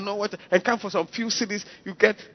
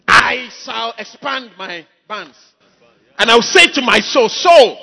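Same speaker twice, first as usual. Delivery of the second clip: slow, louder self-satisfied boast of the rich man; rich fool boasting; 8 dB louder, slower than usual. Retold or imitated. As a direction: swell up like a smug rich farmer. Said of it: "the guy who said".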